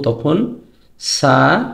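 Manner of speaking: usual speaking voice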